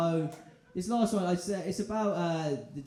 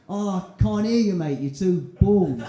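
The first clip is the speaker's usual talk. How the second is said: a cheeky jab to throw him off; cheeky taunt; 3 semitones higher, 7 dB louder than usual. Retold or imitated. Taunting the bald driver.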